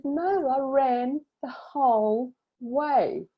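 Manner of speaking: high, drawn-out retold triumph; slow, high emotional proclamation; tearful triumph. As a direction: stretch each word out high, overcome with emotion.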